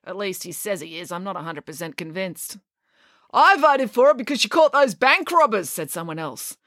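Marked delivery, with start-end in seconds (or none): 0.0-2.6 soft, dropped-voice afterthought; understated, quiet doubt; doubtful aside. A narrator's reading. 3.3-5.7 high, loud crowd testimonial; raised, eager voice; calling out. A narrator's reading. Someone calls out from crowd.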